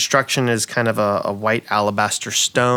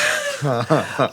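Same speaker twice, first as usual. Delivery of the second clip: diva laugh; 3 semitones higher than usual. Acted. NPC laughs in voice.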